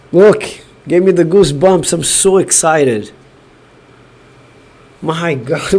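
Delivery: ordinary talk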